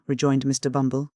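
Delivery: ordinary delivery, nothing marked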